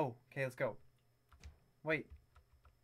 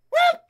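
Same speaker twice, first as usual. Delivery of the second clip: very high, loud yelp; short, sharp "whoop"; startled whoop; 9 dB louder than usual. Real, not acted. Startled during play.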